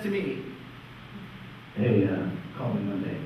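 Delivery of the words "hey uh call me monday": much lower, offhand industry-guy voice; casual creative director. Retold or imitated. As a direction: toss it off like a slick exec. Rival creative director offers job.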